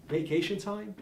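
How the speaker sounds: high, incredulous question; scornful, disbelieving repeat; incredulous echo. Acted. Scornfully echoes a piker's question.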